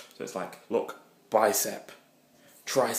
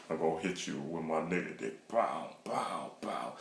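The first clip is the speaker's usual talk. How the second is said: whispered boast; 3 semitones lower, 6 dB quieter, slower than usual. Retold or imitated. Crude boast with sound effects.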